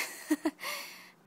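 high delighted laugh. Real, not acted. Delighted at gift.

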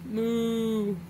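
drawn-out playful moo; cow moo. Pretend. Parent demonstrating for a toddler, playful cow moo. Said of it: "moo like a cow".

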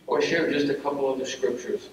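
ordinary delivery

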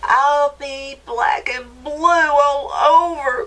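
high, slow, drawn-out mouse cry. A narrator's reading. Mouse at the bottom of the bank, high slow cry of woe. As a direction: cry out slowly as a bruised little mouse. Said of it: "cried Mouse".

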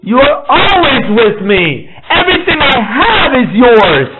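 a father who refuses to defend himself, answers with love; father's loving answer. Retold or imitated. A father embracing his jealous son, high and laughing. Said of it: "the father is like".

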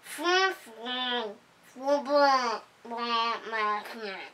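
garbled words round vampire teeth; talking through vampire teeth. Acted. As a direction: mouth full of plastic teeth. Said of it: "could not talk very well, with his vampire teeth in his mouth".

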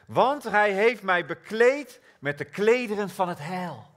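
triumphant preaching line; triumphant answer. Acted. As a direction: land the real reason. The right answer proclaimed.

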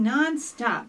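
usual speaking voice

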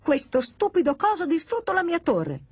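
high-pitched indignant outcry; upset yell. Acted. Pino blames the doll for his tower, yelling. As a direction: protest shrilly without much extra volume. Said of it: "urlò Pino".

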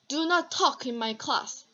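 very slow "Do not", each word separate; stern teacher warning the class; scolding students, stressing Do not; serious emphatic command. Shown as a demonstration. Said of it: "좀 심각한 분위기에 뭐 강조하고 싶다면".

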